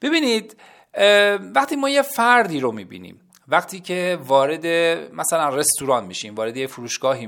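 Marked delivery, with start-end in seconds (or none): none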